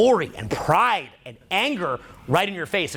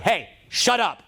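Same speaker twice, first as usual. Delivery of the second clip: mock angry; 4 semitones higher, slower than usual. Shown as a demonstration. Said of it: "I might even look mad at them".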